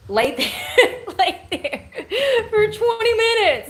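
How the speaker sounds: high, loud, giggling through it; cracking up mid-sentence; laughing. Real, not acted. Joking to viewers about burnt eyes, laughing.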